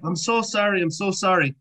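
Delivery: mock-apologetic Canadian, pitched high; Canadian accent. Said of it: "very stereotypical Canadian accent".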